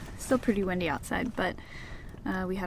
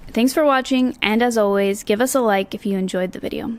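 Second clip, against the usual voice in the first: loud, bright sign-off to viewers; upbeat vlog outro; 8 dB louder than usual. Acted.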